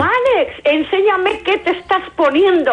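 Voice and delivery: high, slow teasing call. Pretend. Teasing call to absent colleague.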